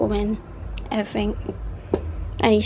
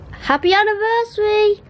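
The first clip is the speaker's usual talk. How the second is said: high happy shout; bright high celebratory call; excited cheer; 10 semitones higher, 4 dB louder than usual. Real, not acted. Shouting anniversary wish.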